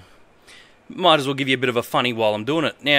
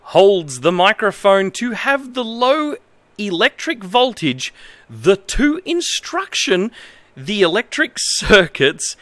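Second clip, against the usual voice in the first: reads broken manual text in a lightly formal voice; straight-faced reading of nonsense instructions; mock-earnest manual reading; 6 semitones higher, slower than usual. Reads bad manual translation aloud.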